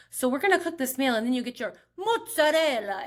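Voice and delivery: mock Italian-American TV chef accent; imitating Gia's Italian. Vlogger mimicking a Food Network host, in a playful Italian-American accent. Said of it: "she'll just be talking like this".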